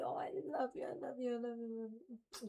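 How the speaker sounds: breathy whispered I love you to the cat. Real, not acted. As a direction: whisper it tenderly into her fur.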